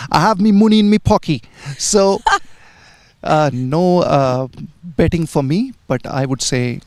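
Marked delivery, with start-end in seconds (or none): 0.1-1.4 put-on broad Yorkshire accent, higher pitched; Geoffrey Boycott Yorkshire accent. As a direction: imitate a Yorkshire cricketer's accent. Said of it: "said in his Yorkshire accent".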